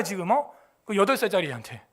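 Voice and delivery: normal talking voice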